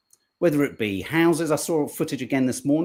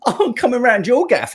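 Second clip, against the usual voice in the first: laughing, higher and louder; 9 semitones higher, 7 dB louder than usual. Real, not acted. Host laughing at Yvette Cooper's bunting, higher and louder. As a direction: burst out laughing as you say it.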